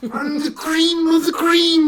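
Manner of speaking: high, loud, drawn-out wrestler boast; hammed-up pro-wrestling catchphrase; wrestler promo. Friend quotes a wrestler's promo to the group, wrestler impression. Quoting Randy Savage's famous promo.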